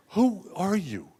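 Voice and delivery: high, drawn-out incredulous question; acted-out astonishment; appalled disbelief. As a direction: ask it as if she's a stranger, pitch up. Stunned question to his girlfriend.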